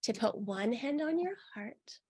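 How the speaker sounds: unhurried meditation guide; guided meditation. Acted. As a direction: lead them gently into the body.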